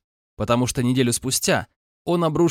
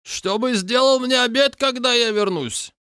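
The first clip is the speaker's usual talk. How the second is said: high, slow mocking order; mocking Caucasian roommate; 10 semitones higher, slower than usual. A narrator's reading. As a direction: taunt him in front of friends. (насмешливый приказ с акцентом)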